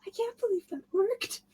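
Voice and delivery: soft, high, half-crying disbelief; disbelieving whisper. Retold or imitated. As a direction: whimper it in disbelief. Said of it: "So, like".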